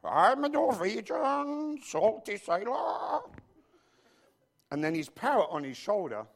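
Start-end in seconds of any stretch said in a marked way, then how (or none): 0.0-3.2 high, slow, hammed-up Norwegian sailor accent. Pastor retelling the Clouseau film gag in a hammed-up Norwegian sailor accent. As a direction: play a goofy old Norwegian sea dog. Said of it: "he's going".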